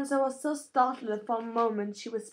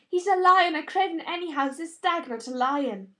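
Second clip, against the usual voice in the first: higher, louder jeering; mocking retort; 6 semitones higher, 8 dB louder than usual. A narrator's reading. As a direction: taunt your sister. Sister mocking.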